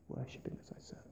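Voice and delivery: whispered echo of the phrase; hushed whispered repetition. Real, not acted. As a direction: breathe it out as a secret. Whispered echo of key line.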